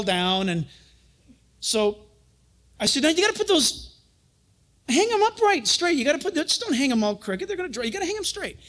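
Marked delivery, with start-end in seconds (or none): 3.0-8.6 bossy instructions. Retold or imitated. Retold bossy shirt instructions to Rebecca.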